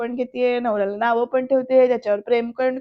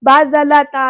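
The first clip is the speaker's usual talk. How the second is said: imitating an elder's sharp, louder rebuke; retold shout; 2 semitones higher, 7 dB louder, slower than usual. Bapu calls out to stop Vidyadhar, retold shout. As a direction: raise the voice to put a stop to it. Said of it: "बापू सुद्धा ओरडून म्हणतात".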